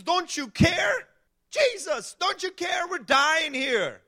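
high, slow, desperate cry over the storm; panicked shout. Acted. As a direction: yell at the sleeping master in terror. Disciples wake Jesus in storm.

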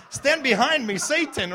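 hammed-up exorcism through laughter; mock rebuke laughing. Pastor mocks givers rebuking money talk, laughing. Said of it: "That's how some of you feel".

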